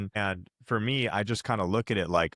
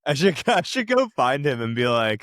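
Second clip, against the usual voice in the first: louder, higher, laughing through it; 7 semitones higher, 6 dB louder than usual. Real, not acted. Answering the host's joke about thanking him, laughing loudly. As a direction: crack up while agreeing.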